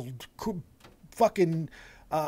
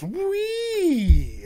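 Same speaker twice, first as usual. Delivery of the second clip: high, drawn-out mock whee; roller-coaster whee; 10 semitones higher, 4 dB louder than usual. Host likening erratic booking to a roller coaster, high whee. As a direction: squeal it high and long like riding down.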